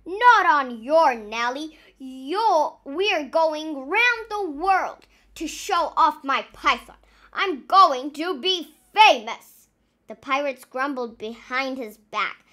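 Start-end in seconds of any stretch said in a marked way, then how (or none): 0.0-9.7 loud, boastful captain; blustering captain. Acted. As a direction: boast loudly to the crew.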